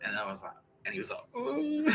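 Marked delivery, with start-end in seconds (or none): 1.3-1.9 high mock ooh of hurt. Colleague reacting to being cut down, mock hurt ooh. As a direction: squeak a wounded ooh.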